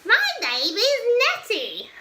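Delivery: high, loud howling monster voice; insulted monster howls at George. Acted. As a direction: howl out the offended reply. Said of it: "with a horrible howl".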